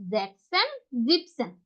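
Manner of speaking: জ্যাকসন with no extra s, জিপসেন with no "-en" before "sen"; two separate demo words, জ্যাকসন rising, জিপসেন falling; dropped stem endings. Shown as a demonstration. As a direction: show জ্যাকসন as "zek-sen", then জিপসেন as "zip-sen". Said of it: "দেখেন এস নাই".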